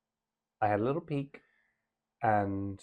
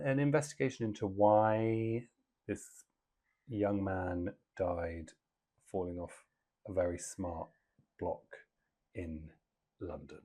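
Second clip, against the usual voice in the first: slow, halting and careful; measured and low, wary of giving too much away; very slowly; 3 semitones lower, 5 dB quieter than usual. Real, not acted. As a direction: pick your way through it, pausing between phrases. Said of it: "I said that very slowly".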